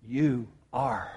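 low, hushed and deliberate; Peter's quiet confession. Acted. Peter answers Jesus with a simple confession, quiet and low. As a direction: say it softly and low, with spaces between phrases. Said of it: "the way I see what Peter says is".

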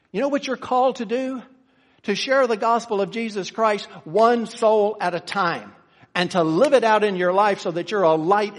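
loud, high, driving preacher's call; preaching at full force. Acted. Preacher urging the congregation, loud fervent preaching. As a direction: rouse the congregation, build it up.

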